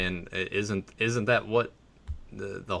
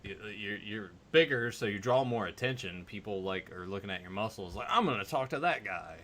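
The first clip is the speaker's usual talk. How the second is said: soft explanation; 3 semitones lower, 9 dB quieter than usual. Retold or imitated. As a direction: talk him down gently. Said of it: "I'm like".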